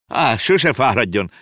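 a polite uncle brushing off the offer; politely declining. A narrator's reading. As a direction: wave it off with polite courtesy. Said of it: "udvariaskodott a bácsi".

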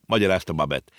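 usual speaking voice

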